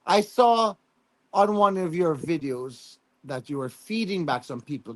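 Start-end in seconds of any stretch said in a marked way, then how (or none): none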